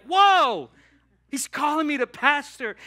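high, loud, amazed exclamation; loud excited wonder; thrilled whoa. Retold or imitated. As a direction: burst out with delight. Thrilled at being called.

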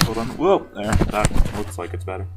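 plain reading voice

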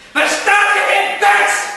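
high, loud, furious roar; bellowing. Acted. Gentleman roaring at the plants to hand her over. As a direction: roar your demand at the villains. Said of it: "Hij brulde".

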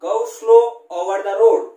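each word spelled out loud and slow, like dictation; slow, loud reading. Shown as a demonstration. Teacher reads the example line aloud to class, slow and loud. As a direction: read Go slow over the road very slowly and loudly. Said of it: "इसको बोलते हैं गौ".

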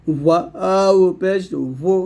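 ওয়াও পেশ ভু very slow, ভু as a round-lipped wu; rounded-lip waw. Shown as a demonstration. Teacher showing learners the rounding, slow rounded waw. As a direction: purse the lips and draw out ওয়াও and ভু. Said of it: "ঠোঁটটা গোল করে".

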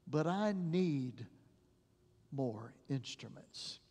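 hushed, sorrowful plea; tearful doctor. Retold or imitated. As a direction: let it sink, almost breaking. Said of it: "with tears in his face".

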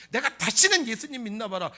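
loud, mock-annoyed retort; irritated vow. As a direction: act fed up and swear off believing. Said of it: "막 신경질 나야 될 거 아니야". (신경질 내듯 큰 소리로)

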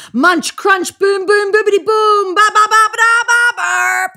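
very high, loud wild noise medley; monster noise. Acted. Animals together scaring the lion, very high and loud racket. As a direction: make the most horrible racket.

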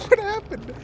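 disbelieving. Real, not acted. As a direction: ask in disbelief. Baffled by teammate's play.

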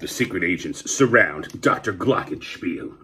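plain reading voice

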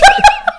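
sudden high laugh; laughing out loud. Real, not acted. Laughs at "fade is fading.

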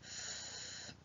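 voiceless f on its own, held; isolated f sound. Shown as a demonstration. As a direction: push out a long f phoneme. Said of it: "first phoneme we can hear".

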